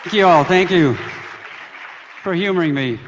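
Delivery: loud thanks over applause. Real, not acted. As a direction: project the thanks to the hall.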